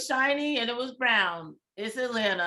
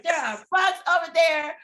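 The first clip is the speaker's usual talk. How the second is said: higher, louder panicked cry; alarmed shout; 7 semitones higher, 7 dB louder than usual. Retold or imitated. Telling the waitress about bugs, a higher panicked shout. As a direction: yell the warning at the waitress.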